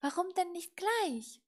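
a pleased little chiding; satisfied. A narrator's reading. Little witch to the broom that gave in, satisfied. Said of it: "sagte die kleine Hexe zufrieden".

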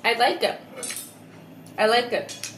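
chewing between words; covering mouth while chewing; mouth partly full. Real, not acted. Seen 1: Bites food just before; chewing motions, hand covers mouth while speaking.

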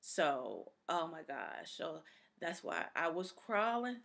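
normal talking voice

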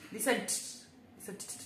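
"Listened" retried, its final t popped; struggling pronunciation. Real, not acted. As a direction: overwork the t ending of "Listened". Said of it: "English is my third language".

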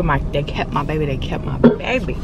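dejected repeated complaint. Real, not acted. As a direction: sigh it out, deflated. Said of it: "My baby is gone".

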